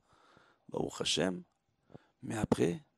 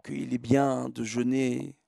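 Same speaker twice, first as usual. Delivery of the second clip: usual speaking voice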